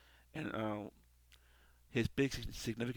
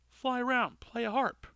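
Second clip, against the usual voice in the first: higher, louder sneering challenge; mocking Al; 12 semitones higher, 6 dB louder than usual. Al scoffs at the angel, a higher mocking dare.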